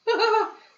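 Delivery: high loud laugh; burst of laughter. Real, not acted. Laughing at her own remark.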